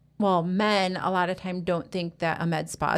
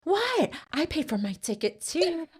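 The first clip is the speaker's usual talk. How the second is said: sharp, high indignant retort; 7 semitones higher than usual. Retold or imitated. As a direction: snap back, much higher. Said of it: "And she said".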